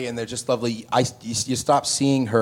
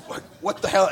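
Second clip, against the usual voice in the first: stunned what the hell; 8 semitones higher than usual. Retold or imitated. Stunned Eddie after punch.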